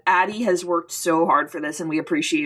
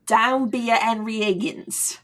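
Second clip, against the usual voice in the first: lighter attempt at British accent. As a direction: say it with an English lilt. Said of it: "now your accent sounds better than mine".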